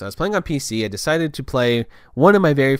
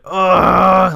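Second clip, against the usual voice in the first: loud, raised "ugh" of irritation; exasperated groan; 8 semitones higher, 10 dB louder than usual. Acted. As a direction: let out an aggravated growl of frustration. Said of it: "it really pisses me off".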